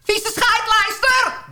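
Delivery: high, slow mock-furious yell; yelling at a seagull. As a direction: shout it up at the thief bird. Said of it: "roep ik".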